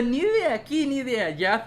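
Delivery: high loud mock of a played-out line; mocking quote. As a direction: scoff at a tired cliché. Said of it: "thing is, like, very played out".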